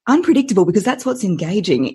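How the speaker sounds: usual speaking voice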